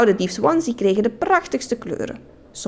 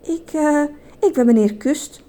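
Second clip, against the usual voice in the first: hesitant, higher-pitched stammer; tongue-tied storybook suitor; blushing, flustered; 6 semitones higher than usual. A narrator's reading. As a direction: stumble over it shyly, with pauses. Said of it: "zei hij blozend".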